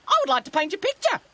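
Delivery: high, loud, fast bird voice; quick high-pitched magpie; magpie character. A narrator's reading. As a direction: blurt it in a squawky magpie voice.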